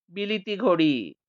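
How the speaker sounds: ordinary delivery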